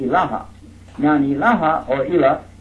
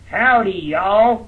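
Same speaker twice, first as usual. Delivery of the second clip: slow, loud Texas howdy, higher; Texas greeting; 5 semitones higher, 5 dB louder, much slower than usual. Shown as a demonstration. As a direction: drawl Howdy, y'all like a Texan. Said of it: "in Texas, people say".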